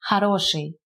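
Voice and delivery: "хороший" said kha-RO-shiy; first O as A. Shown as a demonstration. Teacher explaining the rule to learners, first O reduced to A. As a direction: say "хороший" with an open a first, stressed o second. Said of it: "we pronounce it as".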